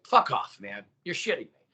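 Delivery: acted-out "get out of here" disbelief; retold incredulous dismissal. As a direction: brush them off in mock outrage. Retelling his disbelieving comeback to someone.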